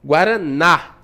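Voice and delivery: guaraná with the final ná set apart and stressed; stress on the last syllable. Shown as a demonstration. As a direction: hit the ná of guaraná. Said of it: "A sílaba mais forte é a sílaba tônica". (guaraná com ênfase no ná final)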